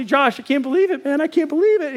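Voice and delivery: can't contain the surprise; amazed. Retold or imitated. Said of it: "I say".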